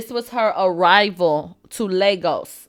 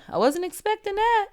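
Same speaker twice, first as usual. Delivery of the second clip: surprised; 10 semitones higher, 9 dB quieter than usual. Real, not acted. Shock at [name] news.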